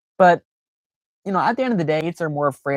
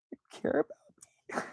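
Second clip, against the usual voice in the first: breathless whisper, much higher; touched whisper; 11 dB quieter, faster than usual. Retold or imitated. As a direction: whisper it, moved and amazed. Retold touched reaction.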